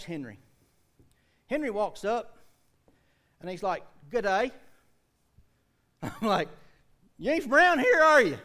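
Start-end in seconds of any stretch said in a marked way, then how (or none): 4.1-4.5 put-on Australian accent. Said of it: "He's talking like some Australian dude". 7.2-8.4 loud skeptical challenge. Retold or imitated. Preacher challenging a stranger.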